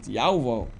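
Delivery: Yahuwah as Yau-vau, falling; model pronunciation. Shown as a demonstration. Teacher showing how to pronounce it well, model pronunciation. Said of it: "how to pronounce it".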